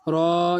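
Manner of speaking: "را" with the long aa held; drawn-out vowel. Shown as a demonstration. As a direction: stretch the vowel in "را". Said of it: "یہ کھینچے گا".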